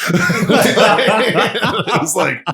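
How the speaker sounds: high laughing disbelief. Real, not acted. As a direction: laugh out the question.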